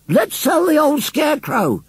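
very high, drawn-out shouted call. A narrator's reading. Woman at the sale urges the crowd, shouting. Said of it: "shouted Missus Briggs".

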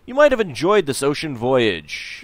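storybook narration of the tavern scene; game text narration. A narrator's reading. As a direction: read the screen text like a storyteller. Reads the game's narration.

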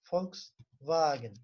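Volkswagen said slowly with an F sound, Folksvagen; German Volkswagen. Shown as a demonstration. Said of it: "ne yazıyorum?". (Volkswagen'i F ile, yavaşça: Folksvagen)

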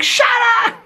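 loud, shrieking-high yell; imitated neighbor's shout. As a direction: scream at the noisy dog like a fed-up neighbor. Imitating neighbor yelling at howling dog.

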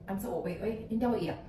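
ordinary delivery

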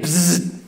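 a short mimicked insect buzz; imitated buzzing. Mimicking the mystery buzz he hears in the bathroom, lips buzzing. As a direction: buzz through the lips like a bug. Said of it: "and I heard it again".

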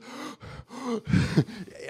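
short-of-breath gasps, imitated; mock struggle to catch breath; fish-like gasping. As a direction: gulp for air like a fish in an aquarium. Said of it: "looking like a fucking fish in an aquarium".

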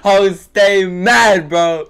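slow, loud mocking drawl on "Oh, stay mad"; gloating taunt; drawn-out taunt. Real, not acted. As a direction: stretch out "Oh, stay mad" to rub it in. Taunting opponent.

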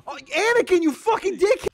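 high, loud exasperated yell; mock outrage. Real, not acted. Furious at Anakin for stealing his health, yelling. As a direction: blast him for stealing your health.

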